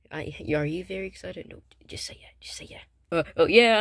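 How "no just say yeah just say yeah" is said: whispered, much softer aside; real whispered prompt. Real, not acted. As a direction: hiss a cue offstage. Prompting the other child aside.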